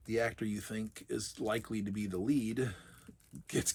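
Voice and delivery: ordinary talk, nothing marked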